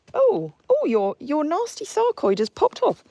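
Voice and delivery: high, loud startled cry; shocked discovery in a high voice; high surprised exclamation. Real, not acted. Discovering horse's wound.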